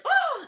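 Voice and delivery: high, loud mock gasp; startled yelp. Snorkeler turning into swarming fish, startled yelp. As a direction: let out a sudden high "oh!". Retold shock on turning underwater.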